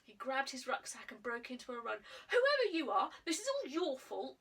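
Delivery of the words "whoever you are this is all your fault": loud, high blaming shout; boy running off, blaming the girl; shouted over his shoulder. Acted. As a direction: throw it back as you run. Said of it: "he shouted over his shoulder".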